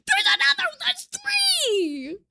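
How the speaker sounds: very high, loud shout of delight; high joyful yell; excited shout. Real, not acted. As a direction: yell out the count in glee. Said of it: "This is so exciting".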